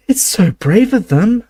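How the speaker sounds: high, slow and admiring; admiring sigh. Acted. Hermione admiring the brave broadcasters, sighing. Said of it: "sighed Hermione admiringly".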